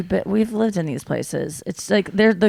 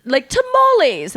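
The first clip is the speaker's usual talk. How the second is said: much higher, laughing mock posh British; posh British accent; 13 semitones higher, 5 dB louder than usual. Mother with accent syndrome on tamales, posh British imitation. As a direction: ham up a Queen's English lady. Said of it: "talking with the Queen's British".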